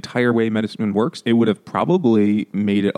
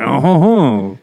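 loud, higher, hammed-up French accent; shitty Frenchman accent; 4 semitones higher, 5 dB louder, much slower than usual. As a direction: do a terrible jolly Frenchman accent. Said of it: "shitty Frenchman accent".

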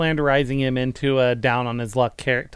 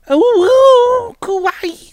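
very high, cutesy squeal; mock kawaii squeal; 6 dB louder, much slower than usual. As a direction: squeal it like a hammed-up anime caricature. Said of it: "like, that kind of thing".

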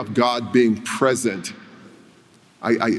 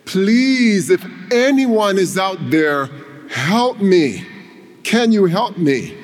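higher, louder pleading cry; a falling climber begs the heavens for help, desperate cry; 8 semitones higher, 4 dB louder, slower than usual. Acted. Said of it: "he cried out to the heavens".

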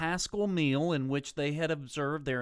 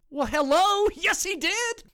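mock retort; 8 dB louder than usual. Mocking retort to skeptics.

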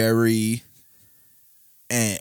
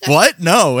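put-on girl, confused; 6 semitones higher, 7 dB louder than usual. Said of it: "And she was like".

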